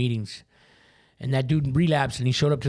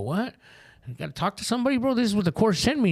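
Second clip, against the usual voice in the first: indignant disbelief; 8 semitones higher than usual. Retold or imitated. Retelling his first treatment intake, outraged disbelief. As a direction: push back, stunned and offended.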